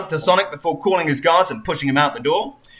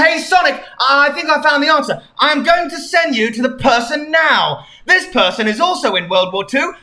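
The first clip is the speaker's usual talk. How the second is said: lightly voiced Tails; 9 semitones higher, 4 dB louder than usual. Tails reports.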